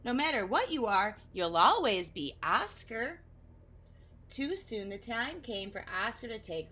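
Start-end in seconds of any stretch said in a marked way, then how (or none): 0.0-3.2 reassuring. Acted. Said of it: "Edna replied".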